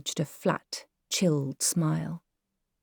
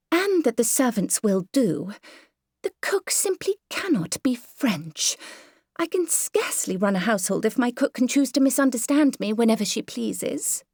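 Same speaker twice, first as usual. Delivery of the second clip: higher, genteel lady fretting about the drawing room; fussy Myrtle voice; 7 semitones higher than usual. Acted. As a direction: play the prim, self-satisfied mother. Myrtle continues complaining.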